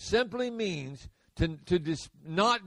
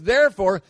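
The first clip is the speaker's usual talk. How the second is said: therefore, louder and punched; stress on therefore; 9 dB louder, faster than usual. Shown as a demonstration. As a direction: hit the word therefore. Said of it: "when you see the word therefore".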